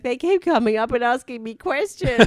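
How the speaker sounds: high, loud retold complaint; Joe's voice. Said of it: "He's like".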